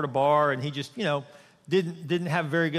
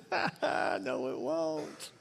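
mocking demon; 7 dB quieter, much slower than usual. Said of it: "they're going".

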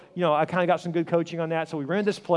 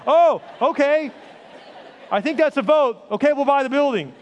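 caught off guard; 10 semitones higher, 8 dB louder than usual. Retold or imitated. Pastor caught off guard by vote.

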